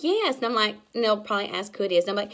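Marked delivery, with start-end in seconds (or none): 0.0-0.4 answering customer. Retold or imitated. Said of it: "And she's like".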